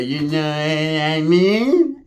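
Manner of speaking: stretched slowly to a rising end; drawn-out mimicry. Busy with her in the bathroom, comically drawn-out words. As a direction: drag the words out in a put-on voice.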